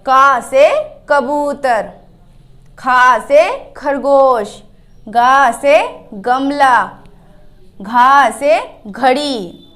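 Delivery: slow teacher drill of letter and example word; slow recital. Shown as a demonstration. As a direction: stretch each word so children can repeat it. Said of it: "उदाहरण के साथ भी पढ़ते हैं".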